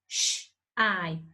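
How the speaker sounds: Sh-I said as two separate sounds, sh then I; sounding out. Shown as a demonstration. Teacher sounding out shy for pupils. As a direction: sound out Sh-I slowly.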